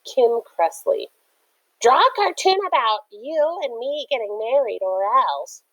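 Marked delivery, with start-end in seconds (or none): 1.8-5.6 put-on high, bossy girl's voice; pushy girl demand. A narrator's reading. Narrator imagines a girl demanding a cartoon, bossy. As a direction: threaten sweetly for a cartoon.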